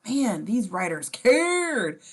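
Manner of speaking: mock admiration, dragged out; sarcastic praise. Real, not acted.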